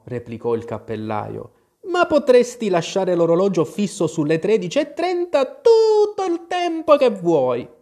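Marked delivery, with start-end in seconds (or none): 1.9-7.7 Mad Hatter. Acted. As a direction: stretch the end in a silly high voice. The Hatter's voice rises strongly. (cappellaio stravagante, voce acuta)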